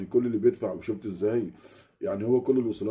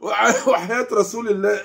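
laughing oath; 11 semitones higher, 8 dB louder than usual. Real, not acted. Laughing oath after the shout.

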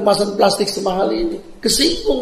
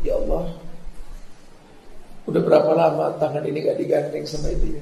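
soft, lower, grieving murmur; mother's sad lament; 3 semitones lower, 7 dB quieter than usual. Retold or imitated. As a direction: sigh it out to God like a hurt mother. Mother's sad inner prayer. (ratapan ibu yang sedih dan pelan)

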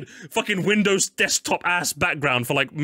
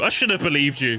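ordinary delivery

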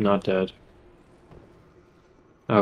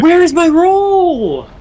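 frustrated yelling; 9 dB louder, much slower than usual. Real, not acted. Frustrated player after dodge fails.

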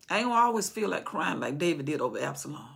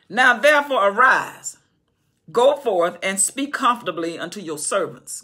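loud, slow, commanding scripture read; scripture preaching; 9 dB louder than usual. Acted. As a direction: proclaim it to the king.

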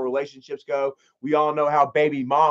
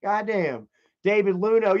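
usual speaking voice

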